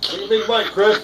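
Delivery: laughing while urging him on. Real, not acted. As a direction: egg him on through laughter. Egging on amid laughter.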